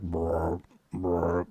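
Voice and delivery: low, soft, rolled "brr" like a frog; low croaking creature noise; imitated frog croak. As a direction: imitate a big creature croaking, kept quiet. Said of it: "croaking like a frog".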